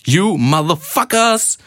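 high, slow, drawn-out mock yell; hammed-up shout. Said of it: "ropte jeg".

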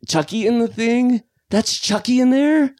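high-pitched disbelieving question; incredulous. Real, not acted. Co-host says the head was Chucky, incredulous. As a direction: squeak it out in disbelief.

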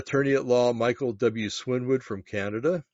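ordinary delivery

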